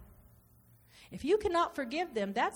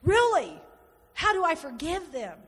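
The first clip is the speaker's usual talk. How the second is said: exasperated outcry; 3 semitones higher, 6 dB louder than usual. Retold or imitated. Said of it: "you're going".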